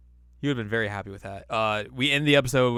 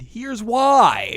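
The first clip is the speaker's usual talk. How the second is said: much higher and louder, very slow; dramatic announcement; 12 semitones higher, 12 dB louder than usual. Retold or imitated. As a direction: announce it like a trailer.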